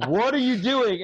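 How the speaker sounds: high, loud exasperated shout; retold TV yelling. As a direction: yell at the TV in disbelief. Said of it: "I'm screaming at the T V".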